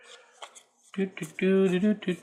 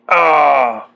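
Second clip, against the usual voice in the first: excited cry; 9 dB louder than usual. Real, not acted. Thrilled by the artwork.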